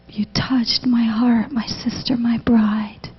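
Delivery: hushed, slow breathy whisper of Jesus; soft voiced whisper, slowed down; tender whisper. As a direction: whisper it like an intimate secret. Jesus speaking tenderly to her.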